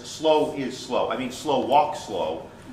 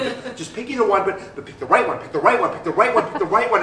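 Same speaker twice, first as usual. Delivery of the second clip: pleading louder and higher each time; building desperate plea; 5 semitones higher, 4 dB louder than usual. Acted. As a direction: beg yourself, climbing in pitch. Pleading with himself, rising.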